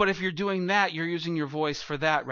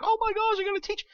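much higher, squeaky mock freak-out; mock panic. Congregant called on to teach, mock panicking. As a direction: imitate a terrified new teacher. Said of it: "you'd freak out probably and be like".

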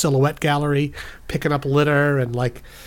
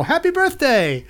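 cheery greeting; 12 semitones higher, 3 dB louder, slower than usual. Acted. Greeting little girls, cheery.

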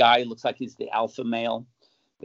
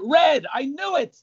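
triumphant gotcha; 3 dB louder, slower than usual. Acted. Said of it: "What's your favorite color".

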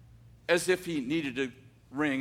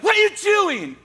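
much higher, loud startled yell; startled shout; 9 dB louder than usual. Retold or imitated. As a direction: yell in shock at being grabbed. Old man grabbed by the boy.

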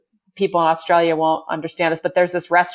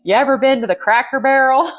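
put-on Southern accent; 7 semitones higher, 3 dB louder than usual. Boyfriend asks about a first date spot, in a Southern accent. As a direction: imitate a Southern guy in a Southern accent. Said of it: "he says in a Southern accent".

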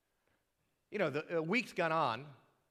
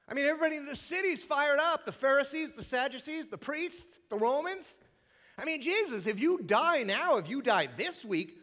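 frantic disciples; 10 semitones higher, 6 dB louder than usual. Retold or imitated. Disciples panicking at Jesus.

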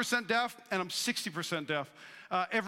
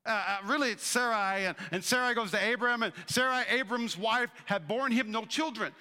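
ordinary delivery

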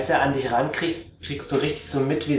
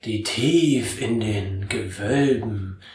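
langsame Flussstimme; 2 semitones lower, slower than usual. Acted. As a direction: drag each word out like something very old. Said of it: "Er sagt". (tiefe, langsame Stimme eines uralten Wesens)

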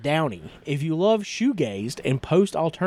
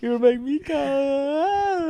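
high, slow, hammed-up breathy line; mock moan; 11 semitones higher, much slower than usual. Answering his cohost's joke, hammed-up slow moan. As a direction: ham it up like a cheesy porn line.

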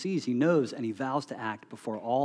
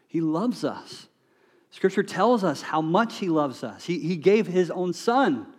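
higher and louder, each "He loves" line a fresh push; impassioned preaching; 5 semitones higher, 7 dB louder than usual. Real, not acted. Pastor proclaiming God's love to the congregation, impassioned preaching. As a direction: pour out God's love on the room.

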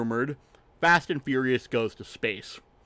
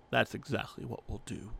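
softer, understated reply in a put-on voice; Dom; 2 semitones lower, 8 dB quieter than usual. Dom answering Roman's outburst about space, quiet hero impression. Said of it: "To which Dom says".

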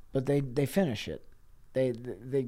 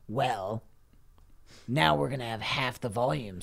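whispered, hushed voice; soft hushed Smallman voice; 2 semitones lower, 4 dB quieter than usual. Retold or imitated. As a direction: meekly bargain in a whisper. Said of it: "So Smallman say".

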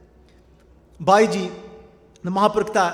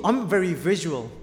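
normal talking voice

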